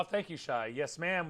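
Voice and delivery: normal talking voice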